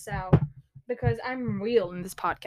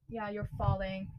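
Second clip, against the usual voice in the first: ordinary delivery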